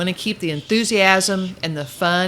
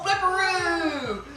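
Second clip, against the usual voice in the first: drawn-out playful; 10 semitones higher, slower than usual. Pretend. Playful drawn-out word to puppy.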